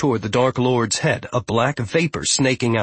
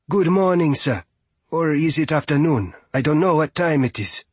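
lightly voiced, higher polite Fia character; polite young visitor; 4 semitones higher than usual. Fia greets the strange steward, politely unsure. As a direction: greet a stranger with uncertain courtesy.

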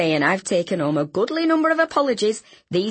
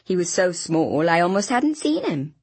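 plain reading voice

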